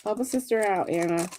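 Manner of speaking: ordinary delivery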